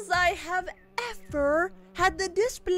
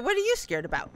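normal talking voice

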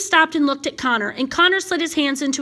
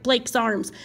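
ordinary delivery, nothing marked

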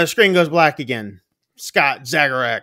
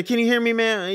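higher, worried call; operator calling; 9 semitones higher than usual. Retold or imitated. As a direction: reach out over the line. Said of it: "you hear the phone operator say".

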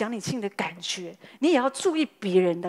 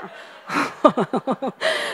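hearty laugh; 3 semitones higher, 3 dB louder than usual. Real, not acted. Pastor's wife laughs with the congregation at her own joke. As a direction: open laugh that rises at the end.

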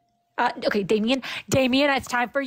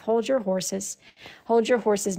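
ordinary delivery

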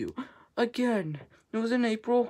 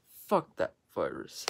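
ordinary talk, nothing marked

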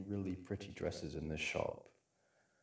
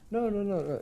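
playful protest; 12 semitones higher, 9 dB louder, faster than usual. Acted. Teasing protest about the wardrobe.

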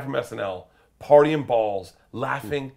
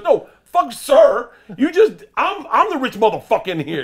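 high, loud indignant rant; Tracy Morgan, outraged; 13 semitones higher, 11 dB louder than usual. Retold or imitated. Comic plays the star berating a waitress, loud outrage. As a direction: imitate an offended big shot blowing up.

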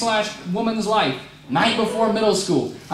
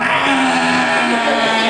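loud high mock wail of a crying kid; 6 semitones higher, 4 dB louder than usual. Blind sixth grader on mom's lap wailing while dad unscrews pipes, loud mock wail. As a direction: wail like a scared kid, hammed up.

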